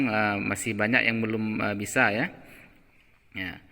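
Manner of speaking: usual speaking voice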